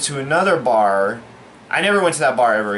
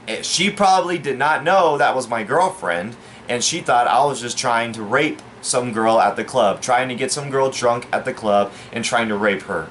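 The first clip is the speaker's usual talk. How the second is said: ordinary delivery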